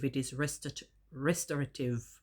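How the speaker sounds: ordinary talk, nothing marked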